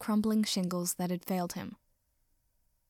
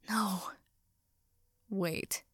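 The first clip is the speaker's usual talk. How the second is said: hushed dread; 5 dB quieter than usual. Acted. Dreading the sunlight reaching Katsuki.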